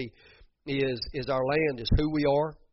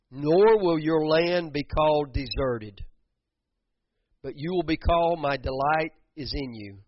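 preaching scripture; 2 semitones higher, 5 dB louder than usual. Acted. Preacher reading scripture aloud.

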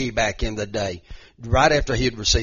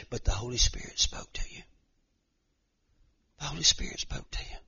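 softer, whispered reverence; hushed whisper; 8 dB quieter than usual. Acted. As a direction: drop to a whisper for the revelation. Revelation told softly.

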